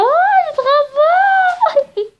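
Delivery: high, loud, slow squeal of delight; thrilled at the finished pompom, squealing. Real, not acted. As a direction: gush over the pompom.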